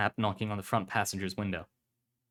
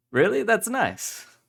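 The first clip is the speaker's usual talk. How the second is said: much higher, delighted-sounding reply; friendly pub host; 13 semitones higher, 6 dB louder, slower than usual. Acted. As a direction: react with loud, high interest.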